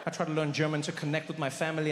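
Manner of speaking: ordinary talk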